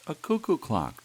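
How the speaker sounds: high, loud joyful cry; delighted cry. Acted. Doris crying out with joy at the gift. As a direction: burst out with delight. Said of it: "Doris cried".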